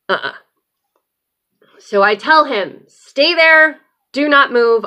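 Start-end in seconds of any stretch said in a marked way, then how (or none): 2.9-4.8 loud, higher, commanding call; firm shouted order. Retold or imitated. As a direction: bark the order so he stays put. Ordering husband not to move.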